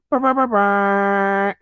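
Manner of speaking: high, loud rolled brrs, drawn out; playful trilled brr. Pretend. Vlogger getting the eggs cooking, playful trilled noise.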